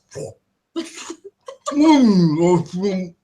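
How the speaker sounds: lightly voiced mayor moaning over food; savouring moans. Mayor with cream on his face, savouring. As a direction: mm through a mouthful, relishing it. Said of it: "it's like".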